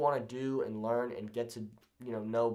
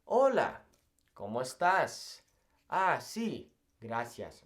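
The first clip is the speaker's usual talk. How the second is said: each word slow and clear; slow Spanish; 2 semitones lower, slower than usual. Shown as a demonstration. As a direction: take your time with the greeting. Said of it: "It doesn't need to be this crazy fast thing".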